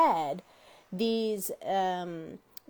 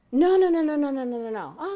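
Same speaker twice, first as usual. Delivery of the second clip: emphatic denial; 5 semitones higher, 5 dB louder than usual. Retold or imitated. Retold dialogue with employee.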